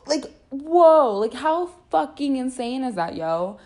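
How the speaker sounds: amazed whoa. Real, not acted. Host reacting to stay-home curfew news, amazed. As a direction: blown away by the news. Reacting to strict lockdown hours.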